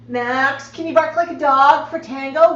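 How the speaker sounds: normal talking voice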